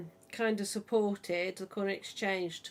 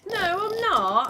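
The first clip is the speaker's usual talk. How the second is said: loud denial; 12 semitones higher, 11 dB louder than usual. Real, not acted. Denies talking politics.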